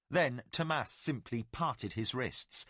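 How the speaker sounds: ordinary delivery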